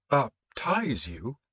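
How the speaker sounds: a priest barely getting the words out; stunned priest. A narrator's reading. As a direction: drag out the disbelief as the priest. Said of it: "Father Pender managed to ask".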